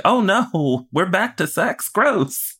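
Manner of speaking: very high, squealing mock disgust; high-pitched fake horror at sex talk. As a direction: squeal it like a grossed-out prude. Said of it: "I was like".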